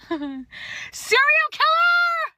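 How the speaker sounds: high, loud laughing yell on killer; hammed-up horrified shout. Real, not acted. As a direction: shriek it in mock horror. Verdict repeated as shout.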